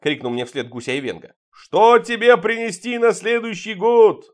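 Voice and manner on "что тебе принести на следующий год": loud, high, slow holler; roaring Father Frost. A narrator's reading. Father Frost calls after departing guest about next year's gift, roaring. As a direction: bellow it after someone leaving. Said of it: "рявкнул вдогонку Дед Мороз".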